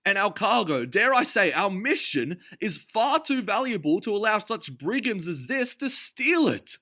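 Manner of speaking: Notley shouting at the sailors; pompous shouting. A narrator's reading. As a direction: bluster like an outraged official. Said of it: "he was shouting".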